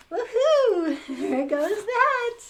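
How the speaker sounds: loud, high woohoo; high joyful children's whoop; kids cheering. Acted. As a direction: cheer like school is out.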